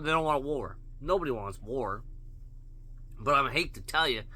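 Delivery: usual speaking voice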